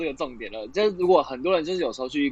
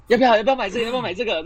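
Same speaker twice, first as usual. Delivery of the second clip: pushy salesclerk; 8 semitones higher, much faster than usual. Acted. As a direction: push the product at the customer. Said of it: "这种的吗？".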